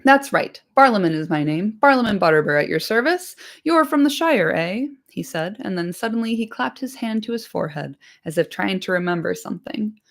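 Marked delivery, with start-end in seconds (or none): 0.0-4.9 proud, friendly introduction; bustling innkeeper. Acted. Butterbur introducing himself to Frodo, hearty and higher.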